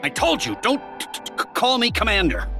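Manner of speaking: high-pitched, exasperated stutter; irked stammering rebuke. Acted. Lieutenant scolds a subordinate for the wrong title, irked stammer. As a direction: correct them testily, tripping over the words.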